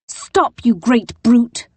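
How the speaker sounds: high, sharp cry at the brute; angry shout. Acted. Poppy clinging to the brute, shouting angrily. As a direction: yell it as she clings on.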